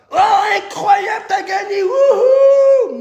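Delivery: high squealing cheer, hammed-up; fake joy. Losing finalist cheering the winner's victory, exaggerated fake joy. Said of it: "Des putains de faux culs".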